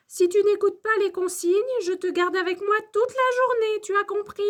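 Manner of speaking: high-pitched, stern warning; maîtresse fâchée. A narrator's reading. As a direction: lay down the rules as a cross teacher. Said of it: "quand la maîtresse se fâche". (mise en garde fâchée, voix aiguë)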